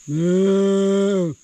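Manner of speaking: loud long cow moo. Pretend. Mooing at the cow.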